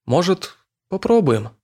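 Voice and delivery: timidly hopeful ask. Acted. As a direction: ask hopefully, half afraid. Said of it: "добавив в голос надежды, с опаской".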